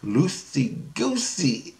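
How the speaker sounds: slow, stretched-out syllables; teaching a silly word. Retold or imitated. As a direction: draw out LOOSEY GOOSEY for a child.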